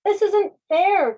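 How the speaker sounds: high, loud, slow protest on the verge of tears; a little cloud about to cry; near tears. Acted. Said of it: "cried the little fluffy cloud, now on the verge of weeping".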